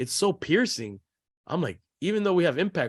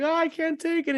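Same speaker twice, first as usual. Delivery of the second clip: imitating distressed daughter. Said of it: "she'd be like".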